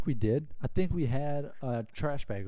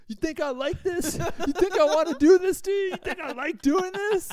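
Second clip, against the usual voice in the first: much higher, hammed-up fake reluctant villain; mock tormentor; 6 dB louder than usual. Friend driving donuts taunting his scared passenger, hammed-up high mock villain. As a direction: tease him like a gleeful bully pretending regret.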